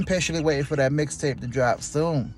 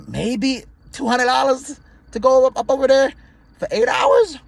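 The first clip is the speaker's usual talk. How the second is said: high incredulous voice; 10 semitones higher, 3 dB louder than usual. Real, not acted. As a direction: pitch shoots way up in disbelief. Incredulous over low pay.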